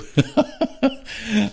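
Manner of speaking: high chuckling giggle; amused laugh. Real, not acted. Vlogger recalling his teasing of rested friends, amused giggle. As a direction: giggle at your own joke.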